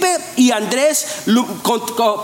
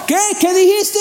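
high, puzzled call across a noisy crowd; a baffled listener who can't hear a thing; confused crowd member; 8 semitones higher than usual. Acted. Gente en la multitud que no oye. (persona confundida en la multitud: aguda, perpleja)

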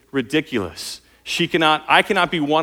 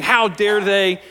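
mock outrage; 6 semitones higher, 4 dB louder, slower than usual. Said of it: "flying off the handle in anger".

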